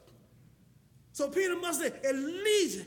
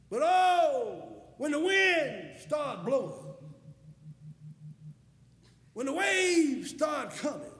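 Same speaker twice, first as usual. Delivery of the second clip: emphatic pulpit delivery with stretched key words; full-force preaching; 6 dB louder than usual. Acted. Preacher telling congregation of Peter on water, forceful preaching. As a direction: preach it hard, pausing between phrases.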